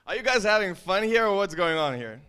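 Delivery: loud, high hype to wake the room up; hyping the crowd. Real, not acted.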